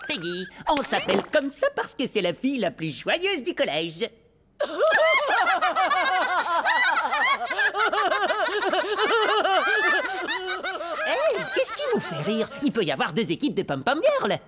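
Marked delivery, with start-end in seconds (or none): 4.6-11.0 mocking laughter. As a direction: laugh them off the floor. Rivals mock the new team. (rires moqueurs)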